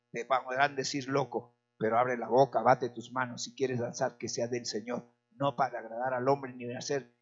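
ordinary delivery

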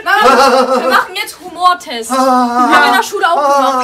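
loud laughing call; yelling across the room. Real, not acted. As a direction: call out over the car, laughing. Kind ruft laut durchs Auto. (laut lachend rufen)

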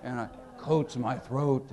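slow and a touch low, confiding; Dirksen's voice. Retold or imitated. Senator explaining the cold cream trick, slow and soft. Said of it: "He said".

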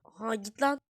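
normal talking voice

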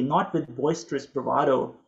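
ordinary talk, nothing marked

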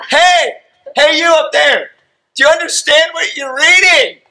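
Philip calling up to the chariot, high and loud. Retold or imitated. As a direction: shout up to the man riding past.

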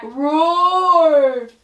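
roaring like a lion; big lion roar at full voice; loud lion roar. Pretend. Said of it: "I'm gonna make this sound really loud".

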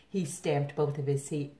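usual speaking voice

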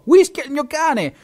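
high, loud indignation; indignant shout. A narrator's reading. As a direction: slam it down, outraged. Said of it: "Poi spiegò". (grido indignato)

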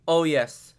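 normal talking voice

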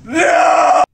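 anguished cry. Acted. Despairing over butler.

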